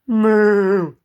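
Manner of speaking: a big loud mooing cow. Acted. Cows answering Thomas with a loud moo. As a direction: bellow it out like a cow. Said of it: "said the cows".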